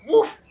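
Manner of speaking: a parrot mimicking the dog; cheeky parrot woof; parrot imitating a bark. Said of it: "a very good imitation of Einstein".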